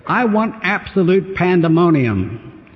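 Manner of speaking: commanding order. Retold or imitated. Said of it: "it was his inflection on the words".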